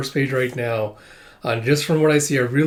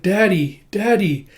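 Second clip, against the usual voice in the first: lightly voiced little girl, higher pitch; child calling Daddy; 7 semitones higher, 3 dB louder, slower than usual. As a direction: call out lightly like a needy child. Child-like creature calling its father.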